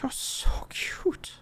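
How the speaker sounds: slow whispered compliment; creepy whisper. Acted. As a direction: whisper it like a hungry predator. Vampire whispers to small creature.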